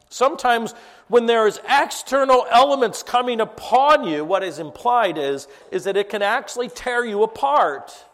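loud, high-pitched preaching; full-force preaching. Acted. Pastor preaching the verse to the congregation, loud and driving. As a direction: drive the verse home to the congregation.

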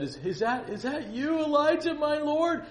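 high, rising, stammered astonishment; awestruck disbelief. Acted. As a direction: ask it as if you cannot believe who it is. Obadiah falls before Elijah.

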